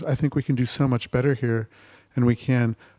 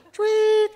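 whistle imitation.

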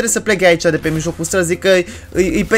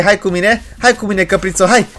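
ordinary talk, nothing marked

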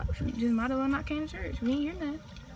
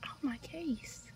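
whisper; 3 semitones higher, 8 dB quieter, slower than usual. Real, not acted. Aside while fixing her hair.

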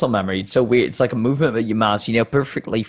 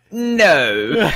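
high-pitched, hammed-up refusal; mock refusal; 6 semitones higher, 3 dB louder than usual. Player answers the opponent who recognized them, mock defiant.

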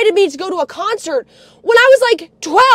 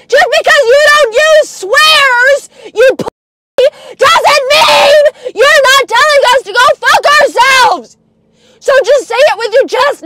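high, loud, near-crying rage; furious tearful shouting; 5 semitones higher, 5 dB louder than usual. Real, not acted. Demanding grandpa say it outright, furious shouting. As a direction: shout it out as if close to tears.

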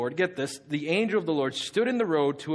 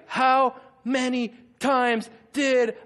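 mock parent scolding, slow and raised, word by word; syllable-by-syllable scolding; 6 semitones higher, 4 dB louder, much slower than usual. Parent whooping a child, imitated word-by-word scolding. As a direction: separate each word like a swat. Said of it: "one of those syllable whoopings".

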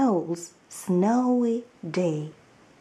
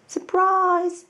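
higher, louder happy shout; 10 semitones higher, 4 dB louder than usual. Acted. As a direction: Mum springs the surprise on Mole. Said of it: "shouted Mum".

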